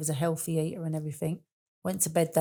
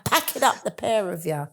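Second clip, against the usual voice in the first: higher, louder sharp scolding; sister's telling off; 3 semitones higher, 5 dB louder than usual. Retold or imitated. Sister scolding the laughing pair at funeral, sharp and loud. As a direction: snap at the two giggling sisters.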